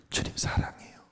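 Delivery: soft whispered love to God; whispered confession. Retold or imitated. Sick brother confesses love to the Lord, in a whisper. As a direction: whisper it tenderly, almost no voice. Quoting a dying man's prayer.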